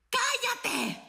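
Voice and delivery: high, loud mock shout; retold mother's yell. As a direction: yell it like an annoyed mom. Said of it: "una voz que me gritó".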